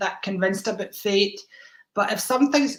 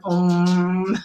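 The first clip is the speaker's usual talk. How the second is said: mock meditation hum; 2 semitones lower, 4 dB louder than usual. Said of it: "sitting cross legs and going".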